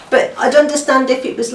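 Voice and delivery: usual speaking voice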